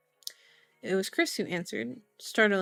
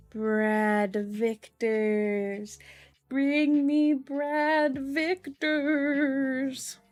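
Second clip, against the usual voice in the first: long-drawn haunting call, rising higher; mock ghostly wail; 4 semitones higher, much slower than usual. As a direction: wail it out very slowly like a teasing fake ghost. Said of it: "you can hear him calling out".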